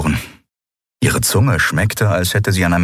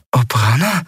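puzzled echo, rising and higher; confused; 6 semitones higher than usual. A narrator's reading. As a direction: repeat the name questioningly. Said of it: "wiederholte Endriel verwirrt". (verwirrt wiederholt)

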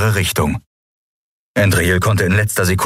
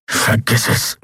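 growled; slower than usual. A narrator's reading. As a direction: growl it off through the pain. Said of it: "knurrte er".

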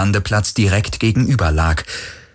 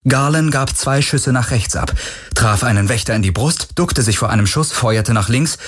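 plain reading voice

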